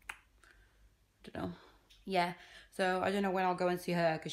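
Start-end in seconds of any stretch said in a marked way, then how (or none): 0.1-1.5 whisper. Real, not acted.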